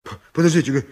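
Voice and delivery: flustered stammer. Acted. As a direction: stammer nervously over the first sounds. Flustered donkey driver protests.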